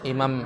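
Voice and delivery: imam said cleanly with a plain i; imam, not ahimam; imam, plain i at the start; correct form. Shown as a demonstration. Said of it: "maksudnya imam".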